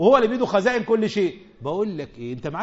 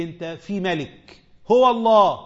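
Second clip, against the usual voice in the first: ordinary talk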